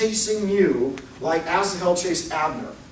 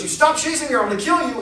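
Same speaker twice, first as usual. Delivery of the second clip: high, rushed warning; acted threat; 8 semitones higher, 3 dB louder, faster than usual. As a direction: threaten fast and high to drive off a pursuer. Abner threatens his pursuer.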